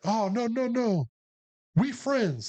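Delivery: high, placating imitation; imitated pleading soldier. Korean soldier reassuring a squadmate, mock pleading.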